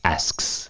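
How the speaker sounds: "asks" breathed out, ending in a hushed sks cluster; model the weird sound. Shown as a demonstration. Teacher showing the odd cluster at the end of asks. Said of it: "That's kind of a weird sound".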